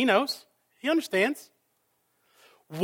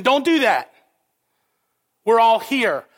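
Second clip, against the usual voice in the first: raised, louder urgent plea; urgent call out; 3 semitones higher, 5 dB louder than usual. Retold or imitated. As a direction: stop him before the sword falls. Paul stops jailer's suicide.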